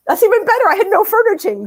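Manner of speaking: thrilled to learn the apartment came furnished; delighted. Real, not acted. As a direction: squeal it out with delight. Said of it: "delighted at furnished apartment".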